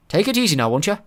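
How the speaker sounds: anxiously. Acted. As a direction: ask gently before takeoff. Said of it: "said Mulch anxiously".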